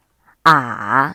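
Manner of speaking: "啊" dipping low, then rising; slow dip and rise on "啊"; third tone. Shown as a demonstration. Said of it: "三声".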